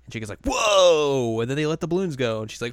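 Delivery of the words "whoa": high, loud falling whoa; awed shout. Retold or imitated. Chica amazed by the balloon thingy, imitated awed shout. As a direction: imitate wide-open amazement.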